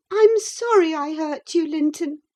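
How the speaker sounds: put-on high, distressed girl's plea; high girl's anguished apology; racked apology. A narrator's reading. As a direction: say sorry near tears. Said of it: "racked beyond endurance".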